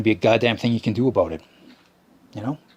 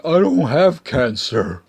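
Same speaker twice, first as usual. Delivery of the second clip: slow, high and louder mock whine; mocking the smoker; 5 semitones higher, 6 dB louder, slower than usual. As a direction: drawl his silly retort in a dopey voice.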